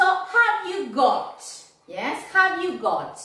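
slow teacher model of the question. Shown as a demonstration. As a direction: have you got said slowly and clearly. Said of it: "teacher modelling question".

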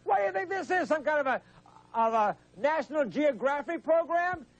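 high-pitched exasperated outburst; offended host talking over a caller; indignant interruption. Real, not acted. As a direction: cut in, pitch jumping up in indignation. Host indignantly cuts off reciting caller.

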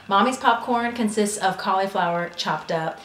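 ordinary talk, nothing marked

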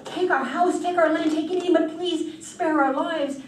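panicked pleading. Acted. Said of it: "The farmer was panicked".

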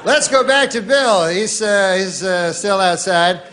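mock TV anchor greeting; hammed-up evening news opener; newsman voice. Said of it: "So they go".

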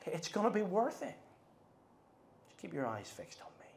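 soft, sinking to a whisper; tender reassurance. Acted. Preacher voicing Jesus comforting the disciples, soft and tender. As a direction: reassure gently, fading to a whisper. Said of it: "but Jesus says".

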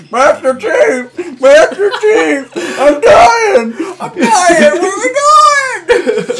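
very high, loud, drawn-out wail; hammed-up shrieking mockery of a game companion; Cortana. Player mocking Cortana's interruptions, wailing shriek. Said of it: "she's just like".

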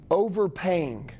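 "overpaying" drawn out slowly, set apart; stress on overpaying. Shown as a demonstration. Coach modelling a sales script, heavy emphasis on "overpaying". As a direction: stretch out the word "overpaying" for emphasis. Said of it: "really emphasize that word".

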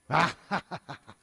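low mocking cackle; demon laugh. Demonio se burla. (risa burlona de demonio)

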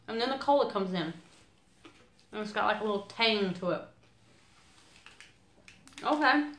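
talking while chewing candy; candy in mouth. Real, not acted. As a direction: mouth partly full. Seen 4: Candy held in mouth; jaw and lip chewing motions after 8s.

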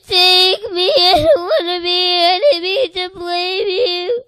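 high, loud, tearful begging; crying plea. Retold or imitated. Child pleading with the Lord, crying loudly. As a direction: pour it out fast through tears.

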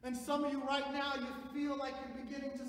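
loud, high, slow proclamation; full-force preaching. Acted. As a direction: ring it out to the congregation.

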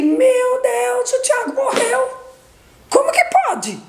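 high-pitched cry of shock, retold; grito de desespero recontado. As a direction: imitate crying out in disbelief, voice leaping far up. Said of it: "eu gritei". (grito agudo de desespero, recontado)

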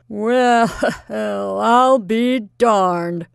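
very slow, loud, wounded mutter; hurt and faintly hostile. Acted. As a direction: grumble it in hurt disbelief. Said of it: "in a hurt and faintly hostile".

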